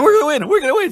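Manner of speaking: high, eager chant of hope; high giddy repeat to his partner; excited. Retold or imitated. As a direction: bounce it up, sure of victory. Retold excitement near game's end.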